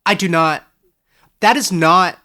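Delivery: sputtering denial. Acted. Young man denies his family is poor, sputtering loudly. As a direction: blurt it out, stung. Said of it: "Edric sputtered".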